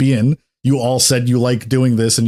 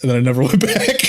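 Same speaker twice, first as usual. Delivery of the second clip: words breaking into a laugh; 3 semitones higher, faster than usual. Real, not acted. Player telling how they quit the guild, laughing. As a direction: finish the story laughing. Laughing at end of own story.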